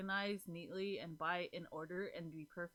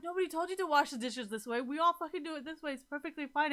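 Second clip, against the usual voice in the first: higher, louder dismissive voice; mocking other signs; 7 semitones higher, 8 dB louder than usual. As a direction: brush off the fussy dishwasher. Imitating other signs mocking her.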